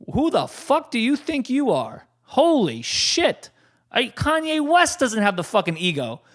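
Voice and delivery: high-pitched indignant rant; angry rant. Retold or imitated. On his birthday, furious at guests who won't chat, high indignant rant. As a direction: let the outrage pour out, climbing high. Ranting at rude wedding guests.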